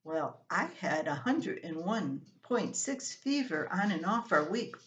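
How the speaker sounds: ordinary delivery, nothing marked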